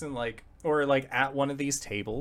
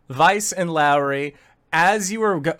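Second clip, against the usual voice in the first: ordinary talk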